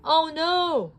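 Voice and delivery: high, loud cry of alarm. A narrator's reading. Buzz sees Woody stolen, high loud cry. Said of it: "cried Buzz Lightyear".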